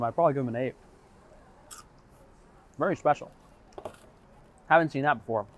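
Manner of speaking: talking while chewing; chewing fries. Real, not acted. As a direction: mouth partly full. Seen 1: holds fries, bites one near 4, chewing between lines.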